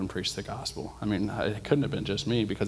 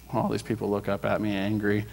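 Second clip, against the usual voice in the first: ordinary delivery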